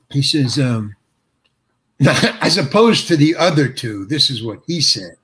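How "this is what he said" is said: usual speaking voice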